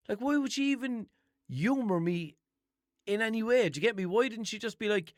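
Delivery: high, loud, exasperated questions; incredulous rant. Real, not acted. Complaining to listeners about the clerk, high and exasperated. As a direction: vent your disbelief.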